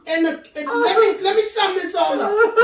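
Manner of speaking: ordinary delivery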